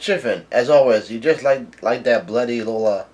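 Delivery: ordinary talk, nothing marked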